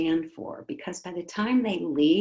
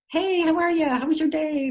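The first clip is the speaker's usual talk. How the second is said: high, loud singsong greeting; cheery parent greeting; 10 semitones higher, 6 dB louder than usual. Retold or imitated. Parent greeting a child coming in the door, high singsong. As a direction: greet the kid brightly at the door.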